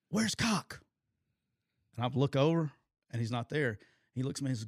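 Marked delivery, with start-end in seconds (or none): 0.1-0.8 high, pointed question; pitched-up, impatient acted demand; angry coach's demand. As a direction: imitate a boss demanding a missing man.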